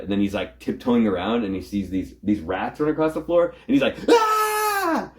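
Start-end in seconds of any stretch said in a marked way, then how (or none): 4.1-5.1 sudden high startled "Ah!" shriek; mock scream. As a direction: yelp like a rat just ran over your foot. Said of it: "why would you scream for that".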